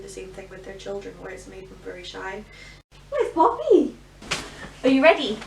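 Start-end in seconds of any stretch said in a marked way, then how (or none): 3.1-5.4 high, loud sing-song call. Real, not acted. Mother calling playfully to toddler.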